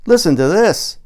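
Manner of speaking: loud, high excited call for attention; eager call. Acted. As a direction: grab the room's attention. Lauren calls everyone to listen.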